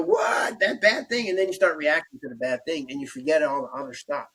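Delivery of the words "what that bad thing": a sudden mock jolt of alarm; startled what. Surfer spotting a bad thought, startled and high. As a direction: jump way up in pitch on what, alarmed. Acting out startled fear reaction.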